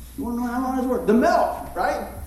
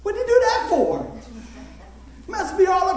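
put-on high, whiny teenage gripe; teen complaint; 9 semitones higher than usual. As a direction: whine like a kid whose plans got ruined. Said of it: "I'm going".

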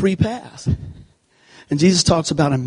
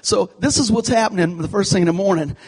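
ordinary talk

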